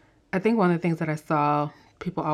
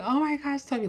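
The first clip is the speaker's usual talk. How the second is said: much higher, thrilled fan squeal; starstruck squeal; 10 semitones higher than usual. Retold or imitated. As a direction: squeal at seeing Tobey. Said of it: "I was like".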